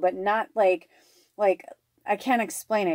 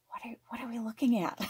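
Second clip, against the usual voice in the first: quick hushed whisper to the dog; 4 semitones higher, 6 dB quieter, faster than usual. Retold or imitated. Crouched beside her dog, whispering what are we looking at. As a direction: whisper so you don't spook her.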